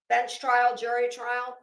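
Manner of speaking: ordinary talk, nothing marked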